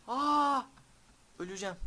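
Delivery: high, loud startled cry; panicked cry. Real, not acted. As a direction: gasp out like you're about to die. Monster attacks, panicked reaction.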